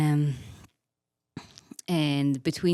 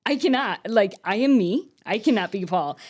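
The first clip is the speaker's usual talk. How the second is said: high, loud protest; insistent, worked-up objection; emphatic refusal; 6 semitones higher, 5 dB louder than usual. Retold or imitated. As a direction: plead your case in comic desperation. Acted refusal to God.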